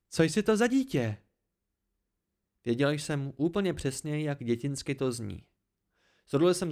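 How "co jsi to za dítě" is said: high, louder contemptuous question; scornful. A narrator's reading. Dena mocks the narrator as a child, scornful. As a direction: throw it out with piercing contempt. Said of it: "pronikavý a plný pohrdání".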